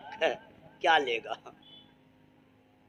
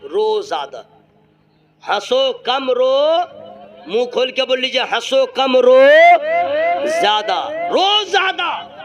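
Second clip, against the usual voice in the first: preaching refrain; 5 semitones higher, 8 dB louder than usual. Real, not acted.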